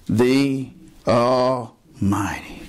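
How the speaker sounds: preacher landing the answer to the congregation; solemn drawn-out answer. Real, not acted. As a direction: draw every syllable out with awe. Preacher answers his own question.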